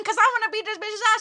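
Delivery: hammed-up threat to fight; imitating her own angry younger self; retold fury. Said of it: "I said".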